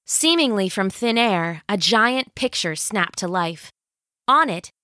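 ordinary delivery

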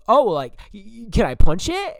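a hammed-up clueless guy asking eagerly; mocking Jerry. Imitating Jerry asking to punch the necklace. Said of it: "And he goes".

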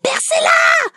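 a sharp high shout in labour pain; screaming. Retold or imitated. Woman in labour screams at the midwife to pierce, shouting. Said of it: "je commence à hurler".

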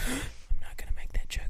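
high mocking whisper. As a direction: whisper it mimicking him. Whispered mock of cohost.

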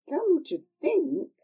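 ordinary delivery

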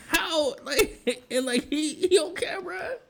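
high, squeezed words through laughter; real helpless laughter. Real, not acted. Host marvelling that old school don't care, laughing helplessly. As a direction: try to talk while cracking up.

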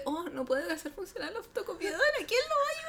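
high, hammed-up damsel-in-distress plea; mock melodrama. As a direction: climb high into a laughing mock wail. Acts out drama plot moment.

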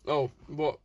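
ordinary delivery

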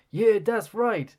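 slow, high hype man backing. Retold or imitated. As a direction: back up the rapper from behind, hyped. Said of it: "some fella in the back will be like".

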